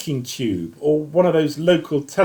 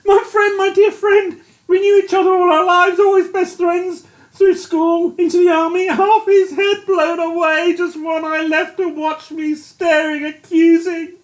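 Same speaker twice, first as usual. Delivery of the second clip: high, loud grief-stricken wailing; a broken soldier mourning his friend; distraught near-sobbing; anguished grief; 4 dB louder than usual. A narrator's reading. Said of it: "He dissolved into sobs".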